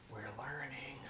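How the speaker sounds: playful whisper; hushed, voiceless little aside. Pretend. Player's hushed aside, mock student.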